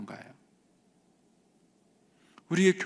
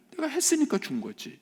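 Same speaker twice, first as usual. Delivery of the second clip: high-pitched mock smugness; hammed-up taking of credit; self-righteous son; 12 semitones higher, 3 dB quieter than usual. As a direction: put on a high, entitled voice. Voicing the legalistic son's thought.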